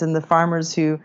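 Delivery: ordinary talk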